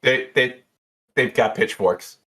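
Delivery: lightly voiced, flustered bartender; a touch of panicky bartender; Jeannie. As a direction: break off the call in mild alarm. Game master plays bartender.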